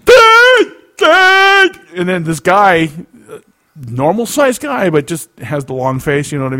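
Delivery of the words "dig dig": high, full-volume holler; loud mock shout, pitched way up; imitated shouting. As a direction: yell it in a high mimicking voice. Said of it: "all I hear is this".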